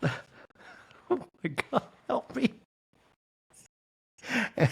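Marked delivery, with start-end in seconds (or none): 0.0-2.5 soft, high mock plea; hammed-up cry for help; mock Sutton. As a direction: whimper it like a helpless heiress. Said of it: "And say".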